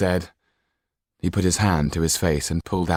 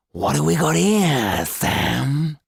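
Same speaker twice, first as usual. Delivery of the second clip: high, slow, leering thug voice; fish-eyed monster; 9 semitones higher, 6 dB louder, much slower than usual. Acted. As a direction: menace the victim slowly. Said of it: "said the fish-eyed monster".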